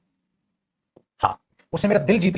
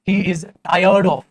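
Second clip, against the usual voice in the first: very slow dictation pace; teacher dictating to class; 5 dB louder, much slower than usual. Shown as a demonstration. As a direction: dictate it slowly for copying. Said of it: "example लिखवाया था".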